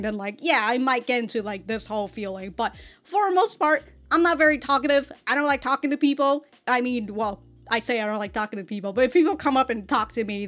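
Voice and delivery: normal talking voice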